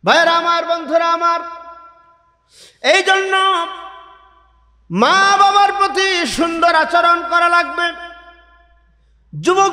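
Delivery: high, impassioned sermon delivery; fiery waz preacher calling out; preaching at full force. Acted. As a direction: preach it out to the whole gathering. Preacher calls the audience.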